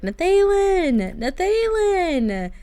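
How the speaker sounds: drawn-out high call of his name. Retold or imitated. Trapped wife calling for her husband through the door, high and drawn out. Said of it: "she's screaming for him".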